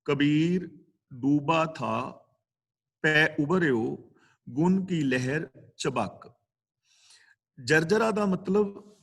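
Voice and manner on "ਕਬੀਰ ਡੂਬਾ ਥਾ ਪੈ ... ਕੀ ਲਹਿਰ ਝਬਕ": slow recital, pausing after each phrase; recited with pauses. Shown as a demonstration. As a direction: intone the couplet with the taught pauses. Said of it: "ਇੱਥੇ ਵਿਸਰਾਮ ਲਗਾਵਾਂਗੇ".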